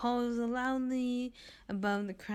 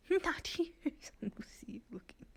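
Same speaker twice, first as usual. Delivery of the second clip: teasing whisper; 8 semitones higher, faster than usual. Acted. Flirty teasing NPC aside.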